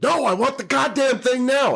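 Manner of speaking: angry yell at the computer. Retold or imitated. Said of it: "I'm like".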